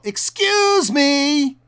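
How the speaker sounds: high, loud, drawn-out yell of annoyance; frustrated yell. Retold or imitated. Cyclist to a walker not watching where he's going, frustrated yell. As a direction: yell it out, stretching the words in exasperation. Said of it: "heard a young woman yell".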